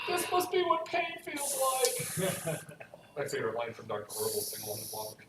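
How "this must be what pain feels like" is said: dramatic quote. Acted. Quote from Doctor Horrible.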